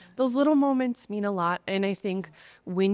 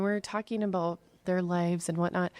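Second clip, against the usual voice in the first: ordinary talk, nothing marked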